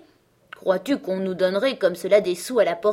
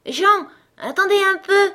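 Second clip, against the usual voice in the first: put-on much higher, louder girlish call; joyful little girl calling; 13 semitones higher, 8 dB louder than usual. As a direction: call out like a happy ten-year-old. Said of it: "cria une joyeuse voix de petite fille".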